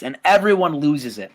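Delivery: normal talking voice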